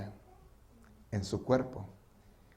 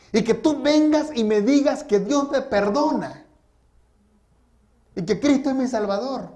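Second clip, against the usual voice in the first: anguished outburst; 7 semitones higher, 7 dB louder than usual. Retold or imitated. Haunted killer protesting to pastor offering forgiveness, anguished outburst. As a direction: cry out in protest, acting a guilt-ridden man.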